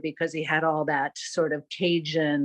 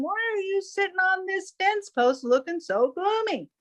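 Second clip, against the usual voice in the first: high, bright-eyed bird voice chiding a friend; chirpy hawk teasing his buddy; cheerful Chicken Hawk; 8 semitones higher than usual. Acted. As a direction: coax a gloomy pal upward. Chicken Hawk talks to Buzzard.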